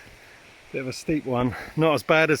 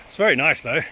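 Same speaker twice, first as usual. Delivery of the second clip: strained, higher and louder from the steep descent; legs burning; 4 semitones higher, 5 dB louder than usual. Real, not acted. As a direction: push through burning legs, words forced out. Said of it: "my legs are burning".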